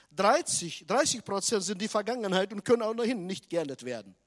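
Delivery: loud, fast preacher's proclamation; full-force preaching. Acted. Preacher hammering the statistic to the congregation, loud and fast. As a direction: drive the point home at full volume.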